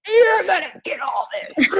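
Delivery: high, strained yell; mock angry outburst. Venting pent-up anger on a best friend, mock furious yell. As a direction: play up the pent-up fury, very high. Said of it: "angry and frustrated in that".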